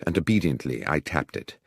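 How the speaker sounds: usual speaking voice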